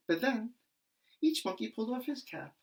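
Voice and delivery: usual speaking voice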